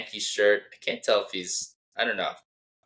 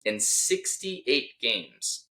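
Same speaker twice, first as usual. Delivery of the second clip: slow deliberate reading; 5 semitones higher, much slower than usual. Shown as a demonstration. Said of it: "here's the tweet".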